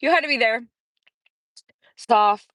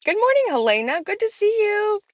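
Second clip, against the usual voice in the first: sing-song greeting; 14 semitones higher than usual. Real, not acted. Greeting a chat viewer.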